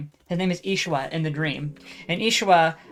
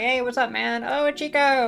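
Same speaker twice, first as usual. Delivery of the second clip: excited greeting; 7 semitones higher, slower than usual. Retold or imitated. Classmates greeting Chico in hallway.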